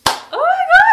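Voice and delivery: very high, loud squeal; excited outburst. Real, not acted. Sudden thought of Paris trip.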